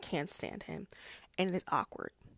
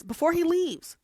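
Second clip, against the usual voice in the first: much higher, louder disbelief; incredulous; 10 semitones higher, 8 dB louder than usual. Real, not acted. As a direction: sound baffled by it. Incredulous aside.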